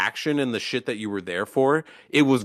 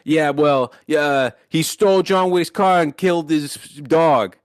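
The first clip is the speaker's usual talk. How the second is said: hammed-up louder movie-villain quote; mock character line; 3 semitones higher, 4 dB louder than usual. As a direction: play it as a cheesy film line, overacted. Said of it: "is like".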